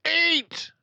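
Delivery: very high, loud explosive yell; shout. A narrator's reading. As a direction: explode with one word. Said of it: "she shouted".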